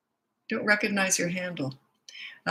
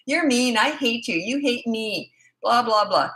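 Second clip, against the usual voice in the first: high, mocking kid's complaint; mock child manipulation; 5 semitones higher, 3 dB louder than usual. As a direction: imitate a child pushing to get their own way. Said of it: "they're not getting their own way".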